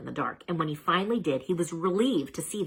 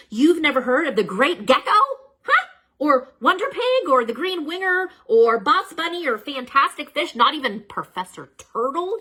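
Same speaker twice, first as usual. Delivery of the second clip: higher, louder, incredulous listing; mock disbelief; 9 semitones higher, 6 dB louder than usual. A narrator's reading. Narrator mock-shocked that listeners never heard of heroes.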